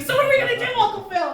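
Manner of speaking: put-on high, loud kid's voice; distressed nephew. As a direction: plead with your uncle in a high, loud voice. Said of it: "My nephew's like".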